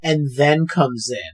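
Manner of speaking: ordinary talk, nothing marked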